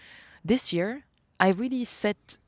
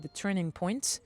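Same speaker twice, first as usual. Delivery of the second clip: ordinary talk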